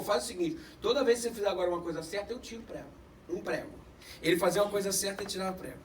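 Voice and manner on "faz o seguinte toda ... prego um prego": lower, much softer grandfatherly voice; hushed old man giving advice; grandfather's advice. Retold or imitated. Grandfather telling grandson how to fix it, low and soft. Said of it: "Ele falou".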